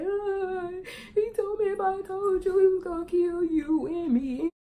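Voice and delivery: high, sing-song crying voice; child crying to mom, high and wavering. Retold or imitated. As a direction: wail it through tears like a scared kid. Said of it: "So I was crying too".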